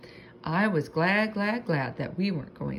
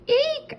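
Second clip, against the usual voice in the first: high, loud hamster squeal; tiny hamster shriek of fear; frightened eek; 6 dB louder than usual. A narrator's reading. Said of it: "I said".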